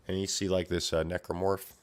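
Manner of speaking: ordinary delivery, nothing marked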